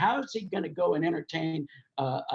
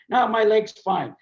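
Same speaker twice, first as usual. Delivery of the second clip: higher, louder acted brush-off; insistent denial; 5 semitones higher, 5 dB louder than usual. Limping Evel answering a worried friend, acted insistent denial. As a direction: shut down the concern about the leg.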